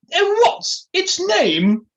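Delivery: very high, loud and drawn-out posh voice; Felicity Forsyth Twike. Acted. Posh lady asking the dog's name, high-pitched. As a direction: stretch it out in an aristocratic squawk. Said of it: "in her high-pitched voice".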